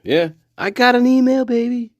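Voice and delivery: high put-on voice; comic boasting. Boasting to viewers about having email, comic high voice. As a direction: show off in a silly high pitch.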